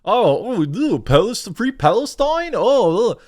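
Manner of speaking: high, whiny mock chant of free Palestine; hammed-up whining protester; mocking Hasan Piker. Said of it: "Hamas Piker going on T V saying".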